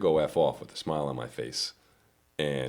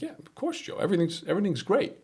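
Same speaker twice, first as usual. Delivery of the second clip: fake cheery reassurance. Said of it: "And I was like".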